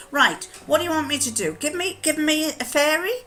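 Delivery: high, quick, lively call for ideas; playful, high-pitched storyteller inviting suggestions; eager prompting. Retold or imitated. Grandmother asks grandchildren for story ingredients, eager prompting.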